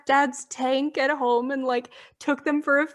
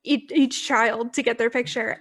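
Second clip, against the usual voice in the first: usual speaking voice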